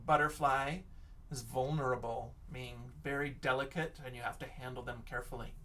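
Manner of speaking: ordinary talk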